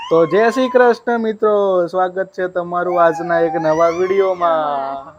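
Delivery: slow vlog opening greeting; vlog intro. Shown as a demonstration. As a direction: present it like a vlogger greeting fans. Father models vlog intro.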